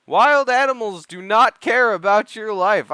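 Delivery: high, loud, emphatic scolding; exasperated loud warning. Pretend. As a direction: drive the warning home at full voice. Loudly warning viewers about animals.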